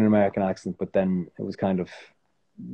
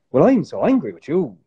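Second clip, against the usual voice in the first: higher, louder, exaggerated singsong lilt; overdone accent anger; 5 semitones higher, 5 dB louder than usual. Shown as a demonstration. Actor showing how angry people don't sound, overdone mock anger. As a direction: ham up the anger with swooping pitch. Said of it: "They don't sound like".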